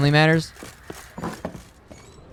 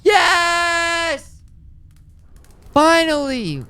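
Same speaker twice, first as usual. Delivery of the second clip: excited shout; much slower than usual. Real, not acted. Triumph in game.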